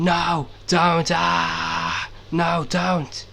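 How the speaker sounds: half-hearted imitation of a screaming victim; mock scream. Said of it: "The guy was screaming".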